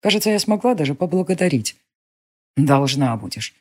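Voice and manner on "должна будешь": casual offhand. A narrator's reading. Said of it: "бросила небрежно верёвка".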